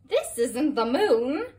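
lightly voiced fly correcting her; buzzing fly. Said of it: "buzzed a huge fly".

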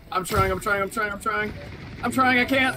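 frantic pleading. Real, not acted. Losing a fight, pleading.

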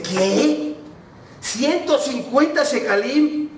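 high, drawn-out disbelief; shocked. Retold or imitated. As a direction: repeat the price aghast, slowly and high.

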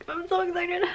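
high, giddy little squeal; excited. Real, not acted. Collector apologizing for bouncing the camera, excited squeak. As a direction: squeak it with barely contained excitement.

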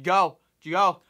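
loud hurried shooing; urgent shoo. Retold or imitated. Manager hurrying him to the gate, a loud urgent shoo. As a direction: push him out the door.

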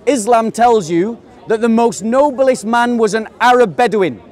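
ordinary delivery

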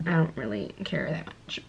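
whispered aside, almost to herself; unvoiced whisper of not caring. Real, not acted. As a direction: drop to a breathy whisper for the shrug-off. Shrugging off makeup talk.

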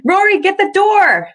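high, loud yell across the house; flustered high-pitched holler. A narrator's reading. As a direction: shout the order way up high. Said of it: "Val yells".

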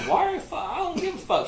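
high-pitched mock exasperation; retold indignant squeal; exasperated disbelief. Retelling his exasperated reply to sister.

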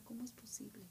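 despairing whisper. Retold or imitated. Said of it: "empecé a llorar y yo decía".

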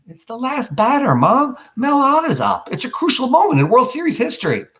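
put-on high, eager boy's protest; teen boy voice. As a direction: plead for the big moment. Boy pleads, voiced by teacher.

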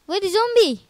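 scared shout. Real, not acted. Scared gamer searching.

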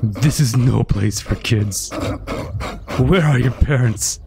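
put-on low, slow monster voice talking down to a kid; a deep creature scolding a lost child; demon frog. Demon frog asks the kid where its parents are, in a low monster voice.

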